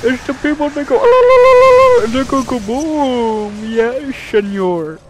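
loud, high hammed-up Mexican accent; mock Mexican accent. Gamer joking about a suicide bomber, hammed-up Mexican accent. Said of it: "That is my Mexican accent".

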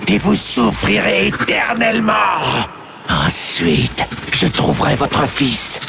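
demon shouting threats, wild swings; raging demon threat. Acted. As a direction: promise vengeance in fury. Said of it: "bouillonner de rage". (menace rageuse du démon à Aléria et Turalion)

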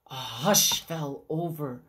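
soft, low whispered narration; storyteller going quiet for suspense; hushed whisper. A narrator's reading. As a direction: draw listeners in with a whisper.

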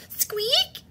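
very high, whispery little squeak; thin high-pitched peep; tiny squeak. Acted. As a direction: pipe it up small and high. Voicing the story's noise.

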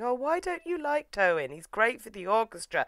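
high-pitched mock coaxing mate; comforting friend. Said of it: "his mate's trying to comfort him".

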